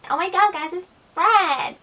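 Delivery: high, loud squeaky excitement; Fred. Acted. Vlogger imitating a YouTuber greeting viewers, high excited squeal.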